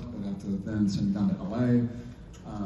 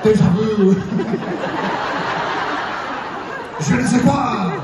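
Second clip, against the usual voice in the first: much higher and louder, laughing through fake French; cracking up while mocking a passionate French quarrel; hammed-up French yelling; 10 semitones higher, 7 dB louder than usual. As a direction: shout stock French phrases like a melodramatic lover. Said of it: "they're just yelling very passionately".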